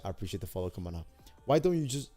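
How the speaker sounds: ordinary talk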